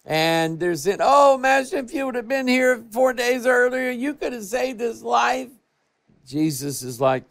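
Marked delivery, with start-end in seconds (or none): 1.0-5.5 high, pleading wail of grief; mock wailing plea. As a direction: cry out to the Master, mildly hammed-up. Mary and Martha crying to Jesus.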